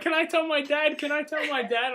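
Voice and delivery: high-pitched pleading mock squeal; put-on high, eager girlfriend voice; imitated tearful excitement. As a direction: beg excitedly like an overwrought girlfriend. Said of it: "She actually started crying".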